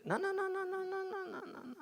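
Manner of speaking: high, fading, mock-squeaky refusal; comic falsetto no, dying away. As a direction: squeal the noes, trailing off. Comic refusal after mock singing.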